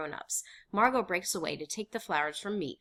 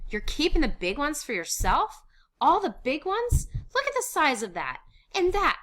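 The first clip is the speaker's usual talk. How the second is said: high, loud, indignant; outraged accusation; 9 semitones higher, 8 dB louder than usual. Acted. Watching Dussel divide potatoes, outraged. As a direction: accuse him at full pitch.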